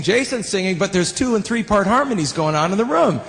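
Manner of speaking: ordinary talk, nothing marked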